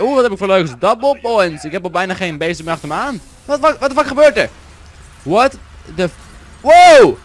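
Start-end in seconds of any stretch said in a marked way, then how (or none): 3.4-6.1 high, loud startled cry; startled outburst. Real, not acted. Verbaasde uitroep tijdens gamen. 6.6-7.2 loud high burst of wow; surprised wow. Real, not acted. Plotselinge uitroep.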